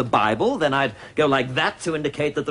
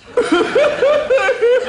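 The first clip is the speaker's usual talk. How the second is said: shrill cackle; 6 dB louder than usual. Acted. Granny figure cackles.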